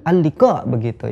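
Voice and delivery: ordinary talk, nothing marked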